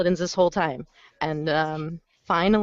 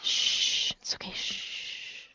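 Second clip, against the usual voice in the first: soft whispered shushing; rhythmic shushing; 9 dB quieter, much slower than usual. Pretend. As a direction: hush them gently, unvoiced. Consoling a sad friend.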